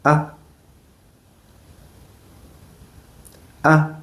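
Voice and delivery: A short and light; a quick, light A with no stress; A in the light neutral tone. Shown as a demonstration. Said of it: "And the neutral tone".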